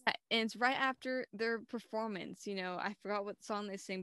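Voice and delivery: ordinary talk, nothing marked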